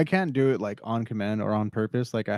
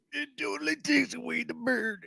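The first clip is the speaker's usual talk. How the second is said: high, soft mock-tearful strain; tearful, breathless; 12 semitones higher, 6 dB quieter than usual. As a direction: catch your breath through mock tears. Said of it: "tears welled up in her eyes".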